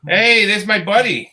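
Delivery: slow, loud welcome call to a buddy; loud greeting. Real, not acted. Host shouting a welcome to the guest joining. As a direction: call out a big hello as he joins.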